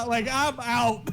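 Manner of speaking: very high, squeaky bail-out; mock-frightened high voice; comic panic. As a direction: pitch it way up, playfully done with this. Player jokes about fleeing the room.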